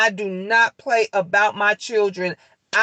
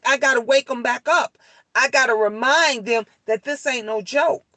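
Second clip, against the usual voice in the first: ordinary talk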